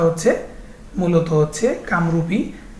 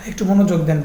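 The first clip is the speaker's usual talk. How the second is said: usual speaking voice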